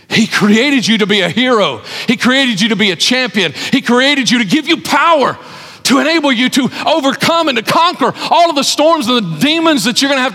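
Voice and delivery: rising fervor, hammering each point to the congregation; preaching at full force. Acted. Preacher building through storm passage.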